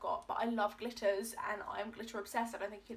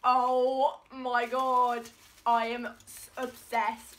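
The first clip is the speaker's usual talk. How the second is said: drawn-out thrilled shriek, much louder; shrieking jackpot winner; 12 dB louder, much slower than usual. Real, not acted. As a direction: scream it like you hit the jackpot. Opening a longed-for gift.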